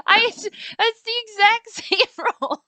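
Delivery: high, loud, slow protest through laughter; laughing disbelief. Real, not acted. Player repeating the shock of the roll, laughing disbelief.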